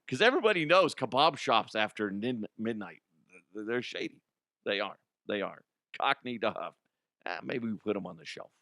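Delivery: ordinary talk, nothing marked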